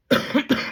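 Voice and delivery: harsh chesty cough; hacking cough; coughing fit. Real, not acted. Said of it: "I'm sorry I'm coughing so much".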